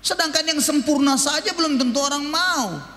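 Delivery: usual speaking voice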